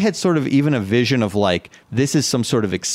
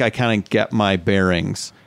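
usual speaking voice

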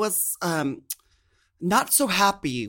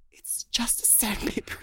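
whispered confession; secretly admitting it's still sandpaper; 8 semitones higher, 7 dB quieter than usual. Real, not acted. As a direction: drop to a hushed whisper.

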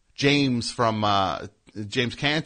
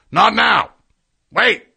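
far higher, full-volume barked order; angry yell; 13 semitones higher, 15 dB louder, slower than usual. Retold or imitated. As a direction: imitate the musician's explosive shout. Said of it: "Get yelled at by a guy".

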